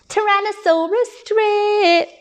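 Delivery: high, drawn-out jeer; taunting, high-pitched announcement; mocking name-calling. A narrator's reading. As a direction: sing out the silly nickname to tease. Sisters mockingly announce his nickname.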